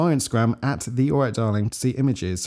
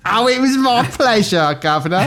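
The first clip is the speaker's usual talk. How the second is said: higher Cockney geezer, laughing; Cockney accent; 10 semitones higher, 4 dB louder than usual. Acted. Answering the thanks as a chirpy Cockney. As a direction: play the chirpy London cabbie. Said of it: "English accent".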